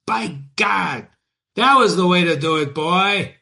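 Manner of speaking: a driver's happy shout to his horse; driver yelling. Acted. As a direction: cheer the horses on, loud and pleased. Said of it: "yelled the driver".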